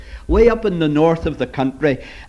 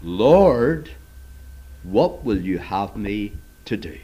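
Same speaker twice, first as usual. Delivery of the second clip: humble surrender; 7 semitones lower than usual. Shown as a demonstration. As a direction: let go of the fight and ask meekly. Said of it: "And now he says".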